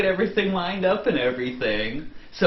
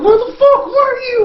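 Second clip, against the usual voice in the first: much higher, loud outburst; angry shout; 8 dB louder than usual. Retold or imitated. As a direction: yell at him for vanishing. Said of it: "they're like".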